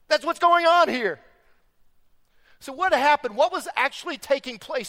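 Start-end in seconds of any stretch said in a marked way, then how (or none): 0.1-1.2 loud, high, impassioned preaching; fiery sermon climax; preaching at full force. Real, not acted. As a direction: drive it home with full force.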